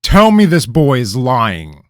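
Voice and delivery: loud, high insistent demand; king ordering his advisor, insistent. Acted. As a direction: order the truth out of him.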